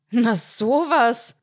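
squeezed out slowly, high and loud, gasping; pressed out, breathless. A narrator's reading. Husband, caught out by a shoe, pressing out breathlessly. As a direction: force the words out short of air. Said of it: "presste Heinz heraus und schnappte nach Luft".

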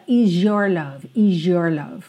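"is your" blended fast almost into a J. Shown as a demonstration. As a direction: let "is your" slur into a J. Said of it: "soa quase como um J". ("is your" quase como J)

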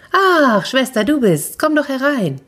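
high-pitched, sing-song welcome; pleasantly surprised hostess, pitched high; delighted greeting. Acted. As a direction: gush a welcome to a visitor. Younger sister greets her visitor.

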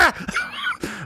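squealing high-pitched giggle; a shrill cackle mid-prank. Retold or imitated.

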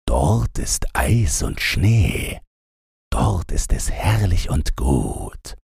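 slow, hushed, longing; dreamy reindeer. A narrator's reading. Reindeer longs for Lapland, slow hushed voice. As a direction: whisper it like a homesick animal.

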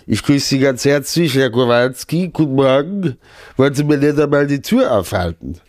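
pompous chancellor greeting the driver; Helmut Kohl. Pretend. Host greets Kowalski at the car door as a pompous chancellor. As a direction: play a stately old statesman being chauffeured. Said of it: "so Helmut Kohl-artig".

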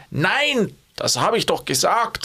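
High German refusal pitched high on "Nein"; mock exasperated insistence; aggressive, annoyed no. Shown as a demonstration. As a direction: snap it as if asked three times. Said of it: "in dem Sinne aggressiver werden".

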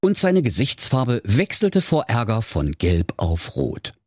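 ordinary delivery

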